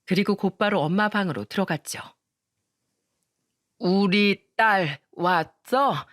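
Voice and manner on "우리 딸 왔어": frail sick mother. Acted. Sick mother greeting her daughter at the bed, slow and tender. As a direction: welcome her daughter with what strength she has.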